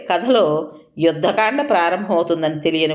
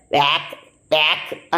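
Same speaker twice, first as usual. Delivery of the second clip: disgusted retching noises; husband gagging while scrubbing the toilet; mock gagging. A narrator's reading. Man gags cleaning toilet.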